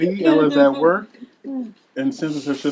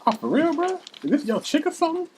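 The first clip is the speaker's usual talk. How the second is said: mocking taunt; 9 semitones higher, 3 dB louder than usual. Gym guy mocking the trainer.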